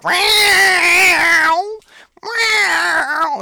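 screeching, very high, loud cat yowl; loud meow. Retold or imitated. The cat looks up at the owner with a loud meow, shrill and yowling. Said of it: "which is the loud meow".

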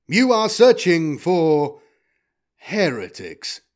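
slow, loud robotic deduction; deliberate, weighty robot voice; war robot. Acted. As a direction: pronounce it like a machine.

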